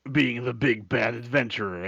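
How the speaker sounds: lightly voiced big bad adventurer. Shown as a demonstration. Player showing the character voice they start with, lightly voiced adventurer. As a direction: play a hint of the bold adventurer. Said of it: "You start, you start here".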